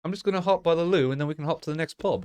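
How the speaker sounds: high, quick mock-British patter; put-on Cockney-style British accent. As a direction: rattle it off like a British pub-goer. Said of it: "Cockney speak, I believe".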